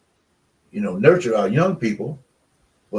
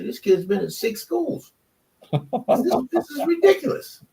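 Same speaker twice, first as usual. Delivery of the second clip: high, slow, exasperated disbelief; mock outrage; 13 semitones higher than usual. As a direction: play up the scandal of it, pitched way up. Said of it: "I say".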